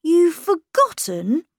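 put-on a boy hurt she forgot; disappointed boy. A narrator's reading. Miles disappointed his governess forgot, high boy voice. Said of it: "He sounded a bit disappointed in me".

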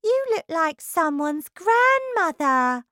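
high, loud taunt; mocking wife. Wife mocking her husband's hair. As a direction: jeer at him.

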